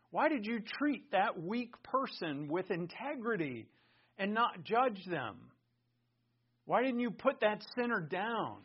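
high, loud, pressing questions; forceful rhetorical interrogation; challenging questions. Acted. Imagined questioner challenges a believer.

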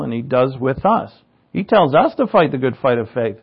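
ordinary delivery

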